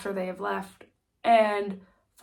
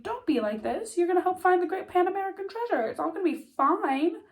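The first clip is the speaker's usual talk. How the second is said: mock upbeat Billy; 9 semitones higher than usual. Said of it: "But Billy's like".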